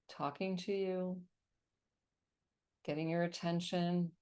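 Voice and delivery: calm, steady meditation guide; guided meditation. Acted. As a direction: lead them gently into relaxation. Leads a meditation on call.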